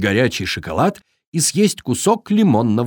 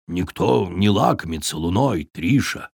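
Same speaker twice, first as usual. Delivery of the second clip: wolf father voice; 5 semitones lower, 3 dB quieter than usual. Acted. Wolf father explains to fawn.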